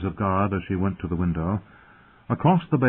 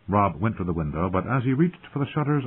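ordinary delivery

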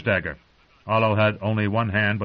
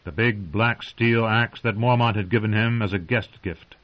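usual speaking voice

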